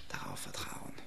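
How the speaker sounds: sinks to a bare whisper. Real, not acted. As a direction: let the words fade into a whisper. Sermon line trails into whisper. (leise geflüstert)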